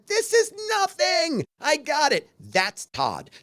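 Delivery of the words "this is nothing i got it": high, slow, breezy dismissal; much higher, slow, airy "this is nothing"; unfazed producer. Retold or imitated. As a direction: wave it all away like nothing matters. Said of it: "Everything was nothing to him".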